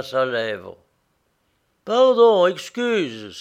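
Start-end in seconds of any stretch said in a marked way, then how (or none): 1.8-3.4 sudden loud, high cry of recognition; exclaiming. A narrator's reading. Prisoner spotting the narrator behind the others, glad exclamation. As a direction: burst out as if just noticing a friend. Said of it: "s'écria-t-il".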